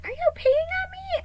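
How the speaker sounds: very high, loud horrified shriek; shocked shriek. Retold or imitated. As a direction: shriek in disbelief at the cat. Gasps, realizes cat peeing.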